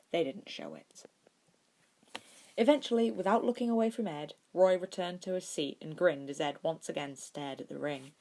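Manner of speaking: usual speaking voice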